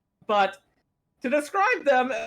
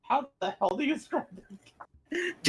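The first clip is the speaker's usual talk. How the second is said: laughing; 3 semitones higher, 5 dB quieter than usual. Real, not acted. Player laughing, lost for words.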